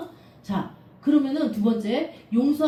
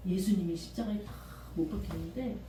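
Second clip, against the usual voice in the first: usual speaking voice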